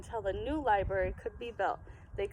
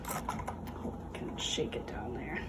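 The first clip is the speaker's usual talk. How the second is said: muttered; 12 semitones lower, 7 dB quieter than usual. Real, not acted. Muttering while working.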